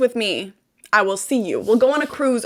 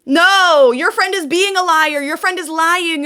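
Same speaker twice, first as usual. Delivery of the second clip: raised pitch, firm and louder; emphatic correction; 5 semitones higher, 3 dB louder than usual. Real, not acted. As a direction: set them straight firmly.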